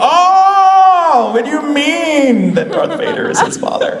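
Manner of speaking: mock shocked. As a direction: act out a shocked spoiler reaction. Mock spoiler reaction.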